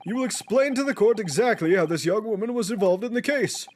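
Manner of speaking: lightly voiced stern judge.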